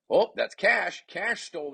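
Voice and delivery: loud, high burst of recognition; excited call. Real, not acted. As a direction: jump in excitedly as the play turns. Sudden steal in the match.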